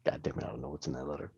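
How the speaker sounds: low, hushed, quick grumble; low muttered dwarf complaint; grumbling Bragg. Acted. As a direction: grumble it fast under your breath.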